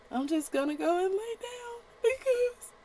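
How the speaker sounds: high, teary, hammed-up whimper; mock sobbing whine. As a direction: whine like a quitter about to cry. Mocking a crying quitter.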